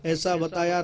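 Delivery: normal talking voice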